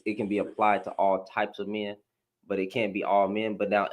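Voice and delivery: ordinary talk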